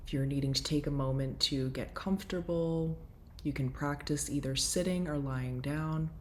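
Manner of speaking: soft, settling guide voice; guided breathwork. Acted. Settling listeners for breathwork, in a soft guiding voice. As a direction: ease the listeners gently into the session.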